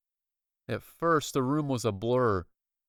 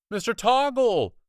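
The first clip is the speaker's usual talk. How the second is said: high, loud relieved call; cried happily; 11 semitones higher, 7 dB louder than usual. Acted. Boy happily calling to the rescuer. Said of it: "I cried happily".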